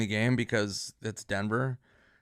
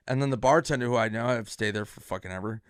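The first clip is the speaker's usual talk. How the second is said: normal talking voice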